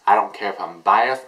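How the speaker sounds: usual speaking voice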